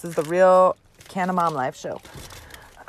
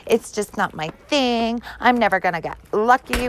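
mock quitter. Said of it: "just quit and be like".

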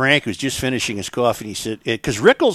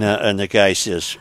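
normal talking voice

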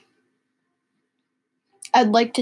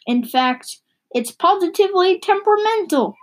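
excited exclamation; 7 semitones higher than usual. Acted. Haroun exclaims in read story.

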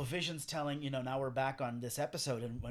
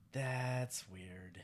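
quiet, lower puzzled aside; puzzled reaction; 4 semitones lower, 7 dB quieter than usual. Acted. Viewer reacting to the lingering camera, quietly puzzled.

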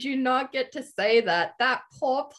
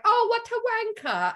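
imitating an offhand British TV insult, high start falling away; casual British accent; 10 semitones higher than usual. Quoting British TV to friends, casual British accent. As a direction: toss it off in a mock British accent. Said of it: "they say it so casually".

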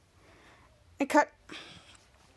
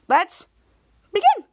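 excited; 5 semitones higher, 6 dB louder than usual. Real, not acted. Hyped opening of recap.

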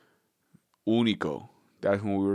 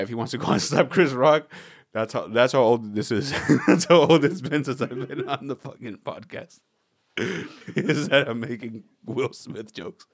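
louder, laughing as the words come out; can't stop laughing at his own joke; laughing through it; 3 semitones higher, 5 dB louder than usual. Real, not acted. Podcast host cracking up at his Will Smith joke, laughing.

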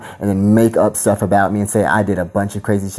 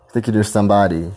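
ordinary talk

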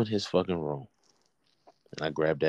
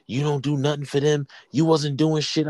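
higher-pitched mock scolding; retold accusation; 8 semitones higher, 3 dB louder than usual. Retold accusation in phone quarrel.

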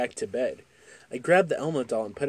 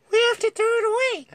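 put-on much higher, fast child; 6 dB louder, much faster than usual. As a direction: rush it like a scared kid. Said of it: "I said".

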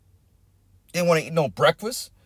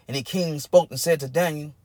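ordinary delivery

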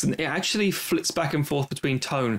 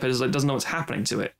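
ordinary delivery

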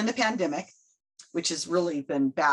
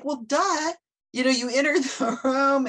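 mock sarcastic duh; 7 semitones higher, 4 dB louder than usual. Mocking the obvious trick.

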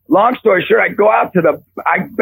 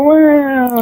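sheepish; 8 semitones higher than usual. Retold or imitated. Reluctant confession to his wife.

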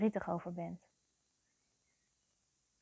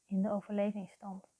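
guided meditation. Real, not acted. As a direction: guide the listener into their feelings, phrase by phrase. (langzame, begeleide meditatie met lange pauzes)

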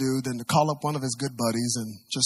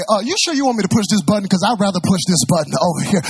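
loud, fast, higher imagined objection; mock second-guessing; 7 semitones higher, 8 dB louder, faster than usual. Passenger imagined arguing with the tower's instructions, mock second-guessing. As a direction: act out a quibbling passenger, quick and loud. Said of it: "how many know he didn't say".

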